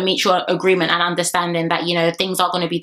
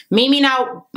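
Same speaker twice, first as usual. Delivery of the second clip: ordinary delivery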